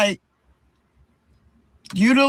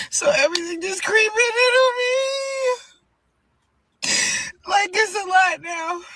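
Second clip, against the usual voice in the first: real tears before the fight; crying; 10 semitones higher than usual. Real, not acted. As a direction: voice breaking high through tears. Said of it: "I don't know why I'm crying".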